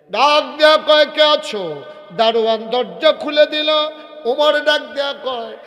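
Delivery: high, loud preacher's shout; full-force high-pitched storytelling; loud calling out. Acted. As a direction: call out as if rousing the guard.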